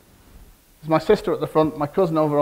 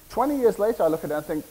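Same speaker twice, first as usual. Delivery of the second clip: ordinary talk, nothing marked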